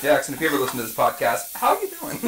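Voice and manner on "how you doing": high chirpy. Acted. High friendly greeting.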